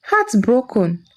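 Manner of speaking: plain reading voice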